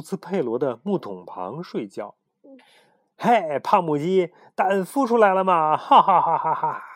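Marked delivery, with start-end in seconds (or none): 3.2-6.8 high, loud mocking taunt ending in laughter. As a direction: jeer at him then cackle. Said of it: "嘲笑他".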